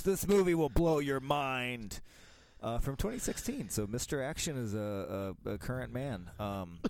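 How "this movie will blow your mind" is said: all caps shout. Retold or imitated. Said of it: "All caps".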